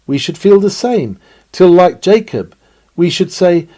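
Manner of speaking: ordinary talk, nothing marked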